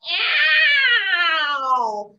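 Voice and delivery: long, loud meow; cat yowl. Retold or imitated. Spider mimics the cats for the owl, loud yowl. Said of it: "the cats would go".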